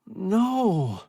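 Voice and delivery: high, loud frightened no; terrified refusal. A narrator's reading. Stranded man refusing the rescuers, terrified cry. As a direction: refuse in sudden terror. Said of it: "face melting with terror".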